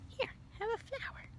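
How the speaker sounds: soft, fast whisper; quick quiet whisper; whispered offer. Real, not acted. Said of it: "whispered aside to child".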